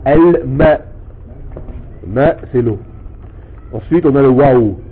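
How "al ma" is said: slow al-ma, lam sounded; al-ma said slowly. Shown as a demonstration. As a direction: model al-ma for the pupil, slowly. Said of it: "On dit al-ma". (al-ma lentement pour l'élève)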